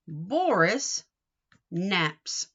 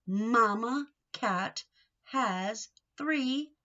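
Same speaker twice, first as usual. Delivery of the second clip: slow title reading; slower than usual. Shown as a demonstration. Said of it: "Read the title with me".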